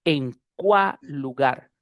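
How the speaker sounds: EN CUÁL LUGAR slowly, "cuál" giving its L to "lugar"; linked consonants, slowed. Shown as a demonstration. Teacher asking students to listen, slow linked pronunciation. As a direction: slowly say "cua-lugar", one L only. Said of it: "Así es como lo estoy diciendo".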